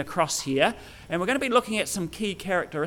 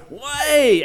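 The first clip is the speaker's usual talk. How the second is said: high, loud, stretched exclamation; amazed disbelief; 7 semitones higher, 5 dB louder than usual. Shown as a demonstration. Reacting as in "for real?", amazed disbelief. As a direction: blurt it out, astonished. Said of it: "an expression of shock or unbelief or surprise".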